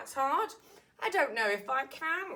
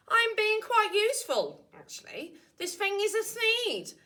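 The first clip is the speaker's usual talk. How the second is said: higher, louder pleading excuses; defensive Once-ler; 9 semitones higher, 4 dB louder than usual. Acted. The Once-ler defending his chopping to the angry Lorax. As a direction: talk your way out of trouble, higher.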